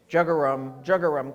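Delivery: deep bullfrog. Shown as a demonstration. A teacher showing the bullfrog deep voice. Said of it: "It's a great deep voice".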